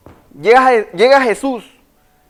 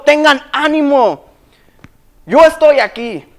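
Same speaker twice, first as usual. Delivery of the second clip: shouted encouragement; 4 semitones higher than usual. Acted. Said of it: "Lo gritó".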